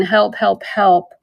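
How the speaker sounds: ordinary delivery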